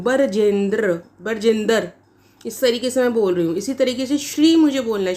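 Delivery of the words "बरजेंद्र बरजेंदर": बरजेंद्र drawn out with an added vowel, "ba-ra-jendar"; stretched mispronunciation. Shown as a demonstration. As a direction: split the opening br of बरजेंदर into "bar". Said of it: "ब्रजेंद्र को मैं बढ़ाकर बोल रही हूँ".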